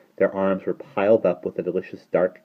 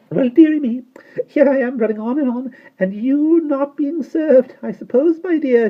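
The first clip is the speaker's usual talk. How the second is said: put-on very high, louder little-old-woman voice; tiny old shopkeeper; 8 dB louder than usual. A narrator's reading. Old shopkeeper boasting to the children, high and chatty.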